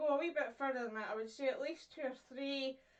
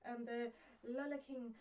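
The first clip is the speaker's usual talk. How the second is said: much softer "happy, healthy hippos"; softly spoken; 8 dB quieter than usual. Shown as a demonstration. Teacher showing soft sounds, gentle and soft. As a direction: let the h's and l's breathe out gently. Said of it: "it's all kind of softly spoken".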